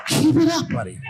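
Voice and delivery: high loud cheer; cheery encourager. Retold or imitated. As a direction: pump him up like a gym buddy.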